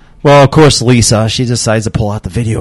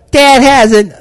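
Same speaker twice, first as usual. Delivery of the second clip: put-on snitching kid; 13 semitones higher, slower than usual. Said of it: "he's like".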